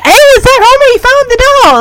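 high-pitched, delighted outburst; mock thrilled discovery; fooled excitement. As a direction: exclaim it high, as if truly fooled. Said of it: "I was fooled at first".

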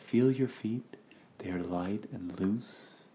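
very soft and slow guidance; slow hushed body scan instructions; soft meditation voice. Retold or imitated. Imitating body scan narrator.

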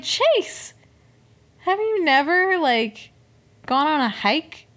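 high-pitched, indignant telling-off; mock scolding. Podcaster scolding a contestant for breaking plants, indignant. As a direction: shoot up high on the name, appalled.

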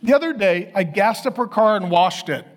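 usual speaking voice

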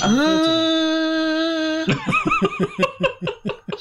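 steady high whooshing hum; hairdryer noise. Pretend. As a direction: blow a long hairdryer whir through the lips. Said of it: "making the sound of a hairdryer with my mouth".

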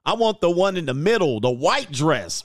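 normal talking voice

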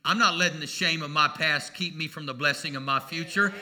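ordinary talk, nothing marked

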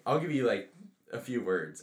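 ordinary talk, nothing marked

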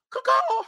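short, very high mimicked crow; rooster crow. Comic imitating the cock in the background, crowing. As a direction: squawk like a rooster. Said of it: "the cock carousel was in the background".

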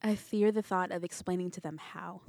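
ordinary delivery

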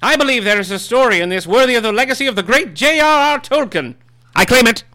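high, loud, pompous gentleman voice; grand self-important literary heir; Christopher Tolkien voice. Acted. Holds the Tolkien character voice.

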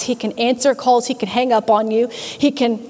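ordinary delivery

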